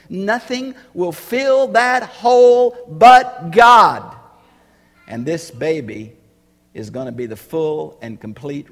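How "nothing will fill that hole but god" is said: loud, slow, high proclamation; preaching shout. Real, not acted. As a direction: cry it out slowly from the pulpit. Preacher climaxes on God-shaped hole.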